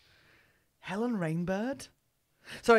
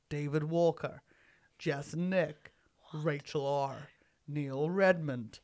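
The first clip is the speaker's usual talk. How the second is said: murder mystery narrator; 6 semitones lower, 4 dB quieter than usual. Acted. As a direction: read the names like a true-crime podcast host. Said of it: "narration for an American murder mystery podcast".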